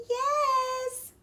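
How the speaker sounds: very high squeaky "yes"; squeak. A narrator's reading. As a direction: fake a squeaky little voice. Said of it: "squeaked the wolf".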